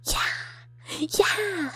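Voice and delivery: high, hushed little cheer; excited cheer. Real, not acted. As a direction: cheer along without really yelling. Cheering in reply to chat.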